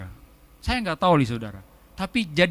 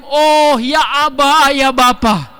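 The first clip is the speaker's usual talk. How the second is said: a louder, higher intimate call to Abba; childlike calling; 3 semitones higher, 5 dB louder, much slower than usual. Shown as a demonstration. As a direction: call to a dad you trust. (panggilan akrab seorang anak)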